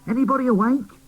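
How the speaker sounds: high, loud call across the room; little doll calling to sleeping toys, loud and high. Acted. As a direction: shout to wake the others.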